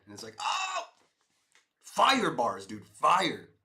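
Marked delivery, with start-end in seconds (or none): 0.4-0.9 high, drawn-out "oh!"; amazed shout. Retold or imitated. Streamer retelling a great rap rhyme, amazed shout. 1.8-3.5 loud, high burst of hype; hyped shout. Retold or imitated. Streamer raving about a rap rhyme, hyped shout.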